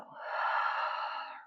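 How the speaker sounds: long, airy exhale through the mouth; demonstrated releasing sigh; open-mouth sigh. Shown as a demonstration. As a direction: sigh it all out slowly. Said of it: "Open mouth sigh and let it go".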